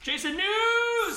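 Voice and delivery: high, drawn-out holler on a held note; jingle-like shout. Pretend. Co-host calling out the Jason news segment, drawn-out shout.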